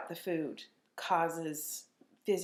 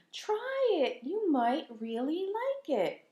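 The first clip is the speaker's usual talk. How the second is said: put-on high, slow coaxing little girl; imitating daughter; 10 semitones higher, slower than usual. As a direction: coax sweetly like a small child. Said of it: "let's pretend this is her".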